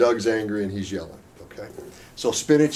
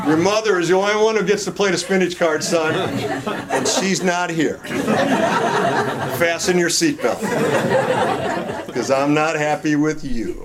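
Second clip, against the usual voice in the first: mock stern dad; 4 semitones higher, 3 dB louder than usual. Dad scolds son, laughing.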